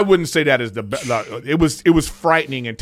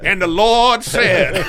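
drawn-out, louder preacher proclamation; mock preacher; 4 semitones higher, 6 dB louder, much slower than usual. Joking about mall churches, mock preacher. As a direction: proclaim it like a sermon, dragging the words.

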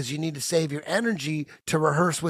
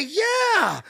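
excited. Retold or imitated. Answering secretary again, elated.

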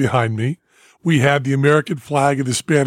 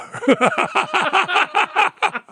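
high falling burst of laughter; big laugh. Real, not acted. Remembering the onstage curse, laughing high. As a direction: laugh hard and let it fall.

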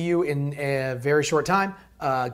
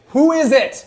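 annoyed shout; 10 semitones higher, 11 dB louder than usual. Acted. Angry at interruption, then caller.

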